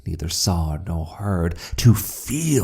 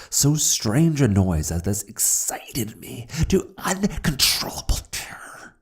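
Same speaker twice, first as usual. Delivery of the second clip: broken, breathy whisper of terror; terrified whisper; 7 semitones higher than usual. Acted. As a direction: let the fear crack through the whisper. Terror peaks.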